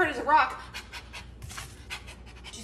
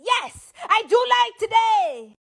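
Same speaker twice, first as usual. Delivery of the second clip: happy shout; 7 semitones higher, 6 dB louder, slower than usual. Acted. Happy reversal of catchphrase.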